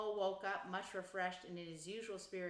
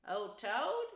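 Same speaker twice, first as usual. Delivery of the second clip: high, amused exclamation; laughing heartily; 4 semitones higher, 7 dB louder than usual. A narrator's reading. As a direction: laugh off the very idea. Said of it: "laughing heartily".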